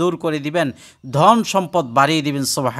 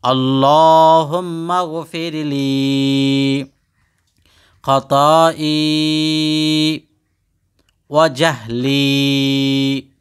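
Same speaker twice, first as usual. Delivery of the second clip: slow chant with every long vowel stretched; drawn-out Quranic recitation; much slower than usual. Shown as a demonstration. Teacher shows students how to recite the dua, slow drawn-out chant. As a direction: intone it slowly, holding each madd. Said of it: "আপনারা আমার সাথে একবার বলেন".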